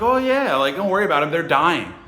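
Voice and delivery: mock-breezy, starting high and loud; dismissive mimicry. Lady telling visitor not to worry, dismissive. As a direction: imitate her waving the worry away. Acting out her brushing it off.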